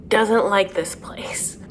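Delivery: slow whispered aside; whispered gossip, slowly; conspiratorial whisper. Real, not acted. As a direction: breathe it like a secret. Aside about Natalie's feelings.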